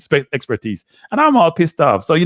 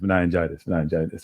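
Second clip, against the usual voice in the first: fading mumble; 7 semitones lower, 8 dB quieter than usual. Pretend.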